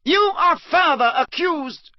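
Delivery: high, hard bellow; stern judge bellowing. Acted. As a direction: bellow the charge down at him. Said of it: "bellowed Mister Crouch".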